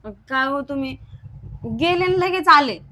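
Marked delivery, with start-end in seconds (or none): 1.8-2.8 angry accusation. Acted. Wife accusing husband.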